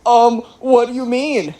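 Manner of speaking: high, slow playing dumb; drawn-out mock-innocent squeak; feigned innocence. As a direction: imitate a kid caught out. Son feigns innocence to mom.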